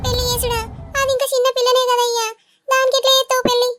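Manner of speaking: a mother aghast at the idea; mother shocked her young daughter may be married off; shocked protest. Acted.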